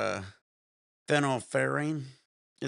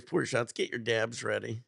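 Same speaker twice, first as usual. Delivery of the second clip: ordinary delivery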